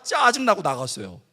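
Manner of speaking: ordinary talk, nothing marked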